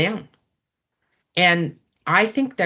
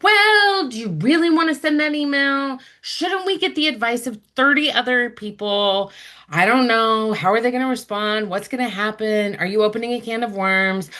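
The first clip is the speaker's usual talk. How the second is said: high, doubting inner voice spiraling with worry; anxious brain voice; 5 semitones higher than usual. Retold or imitated. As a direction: play the nagging overthinking brain. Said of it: "the brain kicks in and is like".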